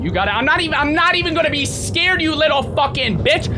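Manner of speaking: high, loud trash-talk threat; defiant yelling. Real, not acted. Streamer daring the game's enemy at a door, defiant yelling. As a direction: yell threats as if fearless.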